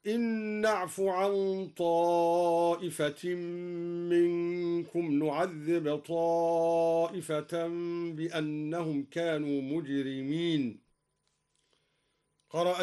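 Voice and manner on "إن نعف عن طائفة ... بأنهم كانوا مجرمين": slow, drawn-out chanted recitation; Quran recitation. Acted. Reciter chanting verses to listeners, slow and drawn out. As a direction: chant it slowly with long held vowels.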